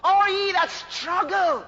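high, ringing, drawn-out pulpit call; preached invitation. Acted. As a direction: call them in with long, swelling phrases.

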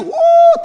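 loud high woo; high joyful whoop. Real, not acted. Preacher whooping after mock insult, loud high whoop. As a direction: whoop with delight.

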